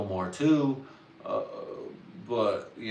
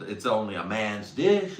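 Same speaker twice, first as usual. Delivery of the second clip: ordinary talk, nothing marked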